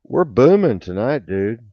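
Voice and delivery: loud, high, thrilled about tonight's numbers; excited. Real, not acted. Host thrilled about viewer numbers, excited loud cheer. Celebrating the show's reach.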